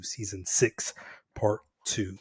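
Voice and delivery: breathy whisper of the title. Pretend. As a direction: whisper it like a secret. Repeats title, then whispers it.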